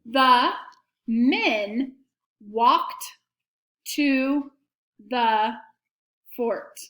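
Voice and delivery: each word set apart by a short pause; dictation, word by word. Shown as a demonstration. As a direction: dictate it clearly, one word at a time. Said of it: "here's the sentence".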